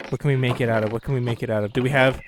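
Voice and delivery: ordinary talk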